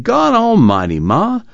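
high-pitched, exasperated whine; complaining. A narrator's reading. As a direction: protest to Ma in a high, aggrieved voice. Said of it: "Al complained".

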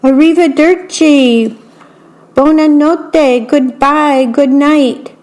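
high, slow, sing-song farewells; calling goodbye. A narrator's reading. Strega Nona and helpers calling farewell to friends, bright calls. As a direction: call out goodnight to departing guests. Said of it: "called out".

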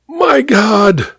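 a sudden high cry of shock; startled cry. A narrator's reading. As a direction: gasp it out as a hand seizes the throat. Smothered by chloroform, gasping out.

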